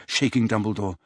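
plain reading voice

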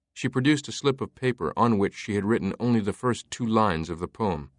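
plain reading voice

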